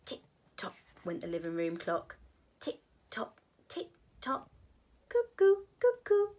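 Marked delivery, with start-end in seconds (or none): 2.6-4.5 ticking clock. Acted. Clock sound in story. 5.1-6.3 high, loud sing-song cuckoo call; cuckoo clock. Acted.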